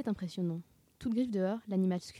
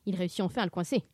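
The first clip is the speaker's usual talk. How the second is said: usual speaking voice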